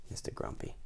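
plain reading voice

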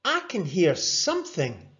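puzzled pal listening hard; lifted young voice; Rory's friend Angus. A narrator's reading.

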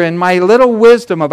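normal talking voice

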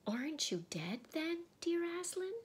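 put-on high, wondering girl's question; awed girl. A narrator's reading. Lucy asks the living Aslan, awed high voice. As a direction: lift it high in timid wonder.

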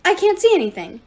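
high, loud panicked cry; sudden panic. A narrator's reading. Nyx unable to see in darkness, panicked cry. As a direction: cry out in rising alarm. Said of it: "suddenly panicked".

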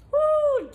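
high, loud whoop of delight; excited whoop. Real, not acted. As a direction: belt out a happy whoop. First bite taste test.

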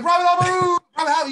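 loud, high call across the formation; calling out names. Retold or imitated. Sergeant calling the private out of formation, a loud high yell.

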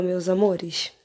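ordinary talk

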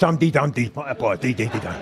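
low, soft muttered chant; wee boy playing dead.

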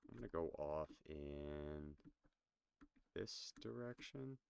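ordinary talk

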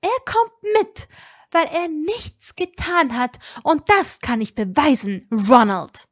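furious threatening outburst. A narrator's reading. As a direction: wag the finger with your voice. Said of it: "drohendem Finger".

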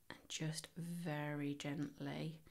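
slow, careful whisper while pulling gently. Real, not acted. Crocheter guiding a delicate step to viewers, whispered slowly. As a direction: breathe the words out as you ease the yarn. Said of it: "I don't know why I'm whispering".

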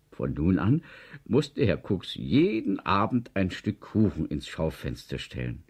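usual speaking voice

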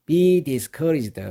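very slow, each word laid out for learners; slow model reading. Shown as a demonstration. Teacher to students, very slow model reading. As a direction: read it out slowly for students to copy. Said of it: "be discouraged가 되는데요".

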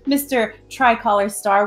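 ordinary talk, nothing marked

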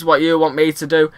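ordinary delivery

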